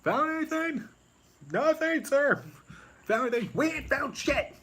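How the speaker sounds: high, put-on trooper voices searching; squeaky troopers trading search reports; Spaceballs troopers. Troopers report to their officer, high comic voices.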